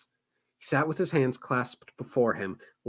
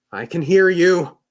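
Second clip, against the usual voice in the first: anxious; 6 semitones higher, 12 dB louder than usual. Acted. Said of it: "the guard said anxiously".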